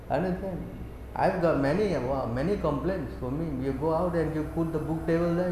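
ordinary talk, nothing marked

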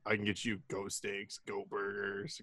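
low, soft, deadpan salesman; Dwight impression. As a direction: murmur it like a shady dealer. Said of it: "he's like".

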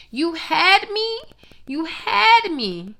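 high, slow, drawn-out mock awe; mock dramatic. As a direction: stretch it out like a swooning fan. Mocking the guest's speech.

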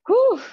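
sharp high loud "whew" exhale; loud emotional exhale after a moving moment. Real, not acted. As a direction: blow out a high, loud "whew" to steady yourself.

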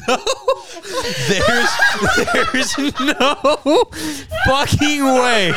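high squealing laugh; laughing hard. Real, not acted. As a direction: wheeze the words through laughter. Laughing at friend's reaction.

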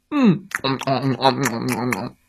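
contented eating hums fading; munch happily. Acted. Little monster eats the bananas, munching hums.

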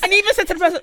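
fast, high, loud retelling; excited. Real, not acted. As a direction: rush it out, worked up.

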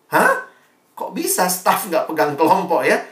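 taken aback. Retold or imitated. Questioning a staffer who leads no group, taken aback. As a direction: react in mock disbelief at the answer. Acts shocked reaction to staffer's answer.

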